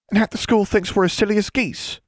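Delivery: high-pitched, aggrieved comeback; indignant retort. A narrator's reading. Constance answers Alexandra's complaint, indignant. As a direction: throw it back, pitch well up.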